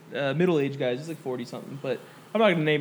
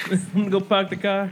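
put-on Boston, R-less park and car; Boston accent. Joining a friend's joke, in a mock Boston accent. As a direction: play at being a Bostonian. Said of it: "from Boston 'cause they have a cool accent".

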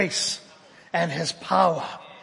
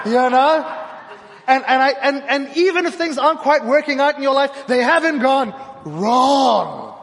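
fervent preaching; 6 semitones higher, 7 dB louder than usual. Acted. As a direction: drive it home, slowing down.

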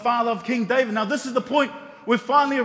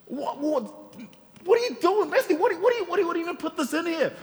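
higher, half-laughing disbelief; mock exasperation; 8 semitones higher, faster than usual. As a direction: throw up your hands at Matthew, laughing. Exasperated rebuke to Matthew.

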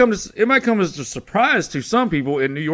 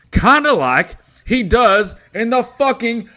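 high, loud angry rant; ranting shout; 9 semitones higher, 7 dB louder than usual. Real, not acted. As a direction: yell it in frustration. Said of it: "sixty seconds to rant".

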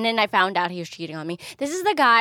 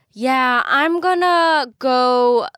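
louder, slow mocking of the carefree ex; mock ex announcing; 5 semitones higher, 7 dB louder, much slower than usual. Woman imitating her ex announcing his move, louder slow mock drawl. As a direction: drag it out like a clueless boyfriend.

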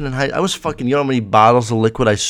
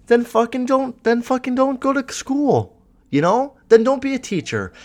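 higher, exasperated scolding; indignant rant; 11 semitones higher than usual. Real, not acted. A vlogger ranting at a teacher who hates pomegranates, indignant and higher. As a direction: tell the teacher off, fed up.

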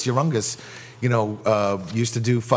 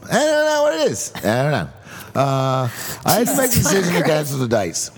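hammed-up British accent, cheerful swooping pitch. Shown as a demonstration. Co-host reads cancellation apology for laughs, peppy British accent. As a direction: read bad news far too happily in mock British. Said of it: "I'll do it in a British accent".

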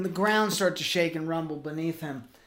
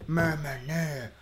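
quieter monster grumble; low creature mumble; 3 semitones lower, 5 dB quieter than usual. Acted. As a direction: mumble it like a beaten beast. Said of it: "And Grimace says".

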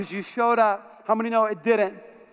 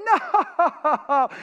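mock laughing denial, pitched up; imitated laughing; 5 semitones higher than usual. Golfing partner mocking his friend, laughing. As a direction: act out a partner cracking up. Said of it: "he was laughing".

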